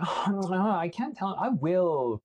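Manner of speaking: high, whiny mock mother; whining. Mother dodging son's question about her boyfriend, high whining. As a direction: whine your way out of the question. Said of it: "She's just whining".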